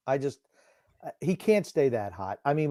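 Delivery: ordinary talk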